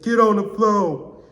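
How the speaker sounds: high, slow, loud officer's shout; retold police command. Police order suspect down, shouting. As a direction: boom it out like an arresting cop.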